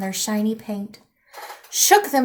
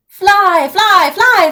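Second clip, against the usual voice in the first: loud, much higher shouting chant; troll shout; 9 semitones higher, 8 dB louder, much slower than usual. Acted. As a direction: shout it after them like trolls. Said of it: "they shouted at me".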